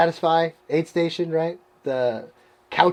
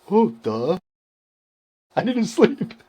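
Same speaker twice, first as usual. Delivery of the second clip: laughing; 4 semitones higher than usual. Retold or imitated. Realizes he forgot to sleep.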